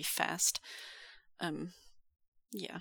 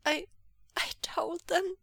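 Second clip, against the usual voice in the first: high, halting, tearful voice; stammering through tears; choked up; 10 semitones higher than usual. Acted. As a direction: choke out a confession. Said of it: "he choked".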